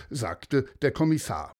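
usual speaking voice

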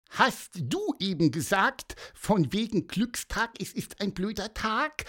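high squeaky quote, rising at the end; questioning little bird. A narrator's reading. Pieper checks what the boy said, high inquisitive chirp.

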